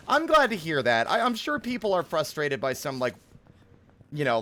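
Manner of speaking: normal talking voice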